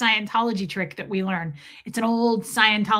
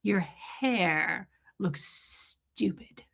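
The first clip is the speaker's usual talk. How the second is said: slow whispered insult; soft slow taunt; 3 semitones lower, 7 dB quieter, much slower than usual. Retold or imitated. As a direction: draw it out softly. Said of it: "she's like".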